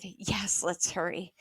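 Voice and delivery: soft, low conspiratorial reply; hushed. A narrator's reading. Sister agrees to slip away, hushed. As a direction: answer low and soft, keeping it secret.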